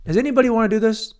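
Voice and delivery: loud, high, quick call to the room. Pretend. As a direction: shout for any volunteer. Calls out for a co-host.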